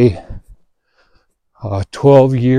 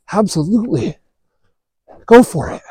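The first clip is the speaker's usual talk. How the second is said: easygoing teammate; 9 semitones higher than usual. Retold or imitated. Teammate lends his bat.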